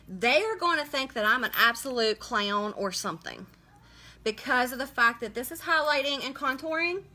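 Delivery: normal talking voice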